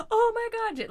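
high, loud panicked exclamation; sudden alarmed cry; panicked gasp. Retold or imitated. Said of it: "and I was like".

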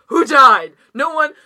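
loud, high mock alarm at a ringing phone; annoyed phone answer. Answering an unwanted call, loud mock dread. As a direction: blurt it out like a call means someone died. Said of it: "If you call me, I'm just like".

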